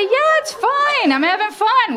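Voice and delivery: hammed-up high voice insisting it's okay; high-pitched reassurance. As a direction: squeak out that all is fine, stretching it. Acting out her own reassuring reply.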